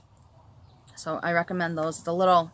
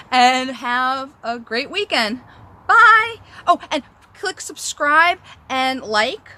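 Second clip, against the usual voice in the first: louder, higher upbeat goodbye; excited vlog farewell, louder; cheery sign-off; 7 semitones higher, 8 dB louder, slower than usual. Real, not acted. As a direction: sign off bright and big.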